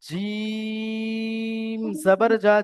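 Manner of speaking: "জিম" held very long, "জা" short; slow drawn-out "জিম", then a brief "জা"; three-alif stretch. Shown as a demonstration. Teacher correcting a student, "জিম" stretched long and "জা" released. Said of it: "জিমকে তিন আলিফ টান দিন".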